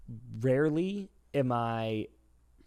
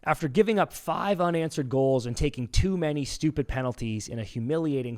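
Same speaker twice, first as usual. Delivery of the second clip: quizmaster; 3 semitones higher, 5 dB louder than usual. Acted. Host asking Dan the next trivia question, quizmaster read.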